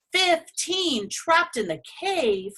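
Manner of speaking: high, slow moan of dismay; a dragon dismayed at the news; drawn-out worried moan. Acted.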